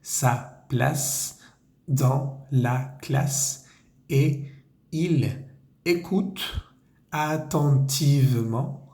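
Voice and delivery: slow, careful French phrase read for repeating; slow teacher dictation; slow model reading. Shown as a demonstration. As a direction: read each chunk slowly for learners. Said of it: "toda esa frase queda".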